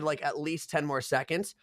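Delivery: normal talking voice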